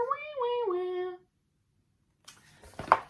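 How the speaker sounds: high fake wah wah baby cry; mock crying for Baby Bear. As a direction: pretend to bawl like a cub. Narrator mimics crying bear.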